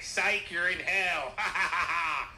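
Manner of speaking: gloating villain reveal with cackle; loud, high, drawn-out mock taunt, then evil laugh; Vlad. Trickster revealing you're in hell, gloating mock cackle. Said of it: "rip off angel wings and be like".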